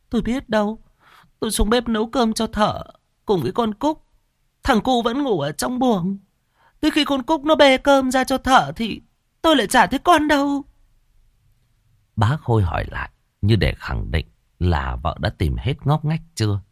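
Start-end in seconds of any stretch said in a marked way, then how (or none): 0.1-10.6 high, tearful whimpering wife; on the edge of tears, high and trembling in pitch. Acted. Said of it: "mếu máo". (giọng mếu máo, cao)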